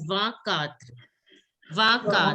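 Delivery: "Vakatra" with an open "va" first; French pronunciation. Shown as a demonstration. Teacher gives French twenty-four to the class, French pronunciation. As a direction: model "Vakatra" for pupils to repeat. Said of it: "twenty-four is pronounced as".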